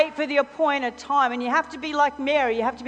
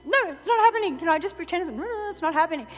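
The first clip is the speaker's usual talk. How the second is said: higher, faster, pleading labouring mother; mock desperate mother; 5 semitones higher, faster than usual. Preacher imitating a mother in labour, pleading higher and faster. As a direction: beg to be done with it, half laughing.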